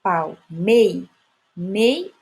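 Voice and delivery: Palmei cut after a strong MEI, then Mei alone; Palmei, stopping on the strong Mei, which is repeated by itself; stress on MEI. Shown as a demonstration. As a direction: split off and punch the syllable Mei. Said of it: "é a última sílaba forte".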